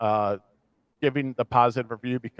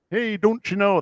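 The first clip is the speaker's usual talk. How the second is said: Wisconsin-Minnesota accent; 7 semitones higher than usual. Shown as a demonstration. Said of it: "that's a Wisconsin, Minnesota thing".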